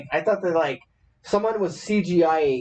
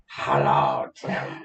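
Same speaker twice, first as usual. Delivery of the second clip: deep voice; much slower than usual. Pretend. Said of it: "cat hair was like".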